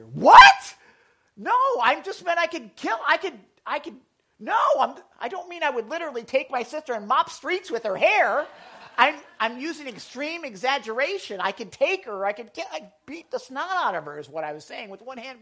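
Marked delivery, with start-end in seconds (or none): 0.0-0.8 high, loud startled "What?"; mock alarmed yelp; shocked what. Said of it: "and I'm like". 1.4-14.2 higher, hurried backpedaling; mildly flustered kid; flustered explaining. Retold or imitated. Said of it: "and I'm like".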